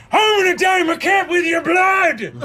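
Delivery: very high, loud manic shout; redcap threat. Acted. Redcap to the fallen player, high manic shout. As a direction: scream like a bloodthirsty goblin. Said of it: "redcap attacks".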